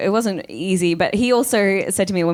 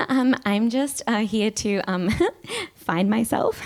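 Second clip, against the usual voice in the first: hesitant, giggly, pitched-up fake innocence; giggly bluffing; 4 dB quieter, slower than usual. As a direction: stall with nervous little laughs. Bluffing the immigration officer with giggles.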